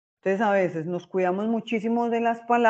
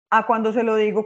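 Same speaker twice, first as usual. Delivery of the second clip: raised voice demo; 3 semitones higher, 7 dB louder than usual. Shown as a demonstration. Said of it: "o le digo y bajo la voz".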